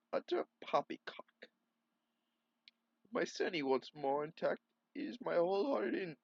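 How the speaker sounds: king speaking to his daughter, lightly voiced. Reads the king's lines in voice.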